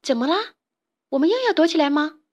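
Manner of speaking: puzzled question. A narrator's reading. Isabella asking the frog if they must hide, puzzled. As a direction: ask it puzzled, pitch lifting high. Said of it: "疑惑地问".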